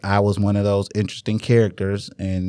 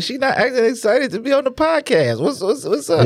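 excited; 14 semitones higher than usual. Real, not acted. Teasing child on podcast.

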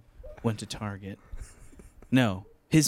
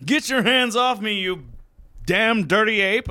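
much higher, loud slow shout; movie quote shout; 11 semitones higher, 7 dB louder than usual. Retold or imitated. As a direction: bellow it like the film hero. Quoting movie line loudly.

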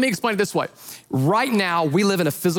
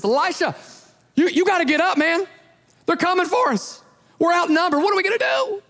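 high, panicked pleading; frantic; 10 semitones higher than usual. Retold or imitated. Servant waking Elisha, frantic and much higher. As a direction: wake him up in a rush of panic. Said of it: "So he frantically wakes up".

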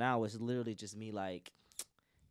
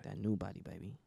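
sexy voice; 2 semitones lower, 3 dB quieter than usual. Pretend. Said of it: "you gotta get the sexy voice on".

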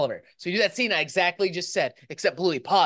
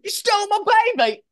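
a high, bad Australian accent, pitched up; attempted Australian accent; 11 semitones higher, 3 dB louder than usual. Mother accusing the dingo, in a bad Australian accent. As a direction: try an Australian accent and miss it. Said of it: "I can't do Australian".

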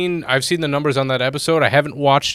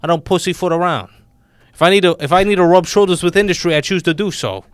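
mobster bravado, louder and pushed; tough-guy Italian wiseguy ranting about Minecraft; Italian gangster voice; 4 semitones higher, 4 dB louder than usual. Acted. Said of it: "I'm doing the Italian voice".

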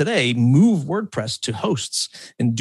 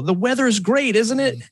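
mock-pleasant small talk, pitched up high; fake-friendly smile; 11 semitones higher than usual. Host mimics a smiling manipulator, fake-friendly and high. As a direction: smile it out falsely before the betrayal, much higher. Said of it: "you smile".

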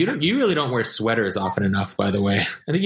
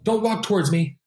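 mock bossy warning; 11 semitones higher than usual. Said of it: "and he was like".

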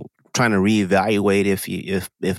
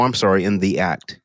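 ordinary talk

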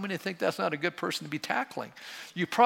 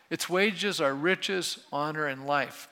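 slow solemn reading; slower than usual. Shown as a demonstration. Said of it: "this little phrase".

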